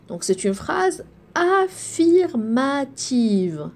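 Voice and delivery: slow, spelled-out model for repeating; slow model sentence. Shown as a demonstration. Teacher modelling a sentence for pupils, slowly. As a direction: say it slowly so they can copy. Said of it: "Répétez".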